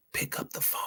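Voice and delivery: breathy whispered advice; hushed whisper of the key line. Shown as a demonstration. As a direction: whisper it like a secret tip. Whispered then repeated plainly.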